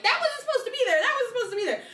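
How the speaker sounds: playfully alarmed bride spotting mistakes; mock dismay. Bride imagining walking down the aisle, mock dismay.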